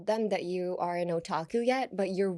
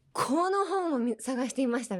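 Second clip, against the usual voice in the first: high, insistent stress on この本; emphatic この; 6 semitones higher, faster than usual. Shown as a demonstration. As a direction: hit この hard, then ease down. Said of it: "これ強調したい時に".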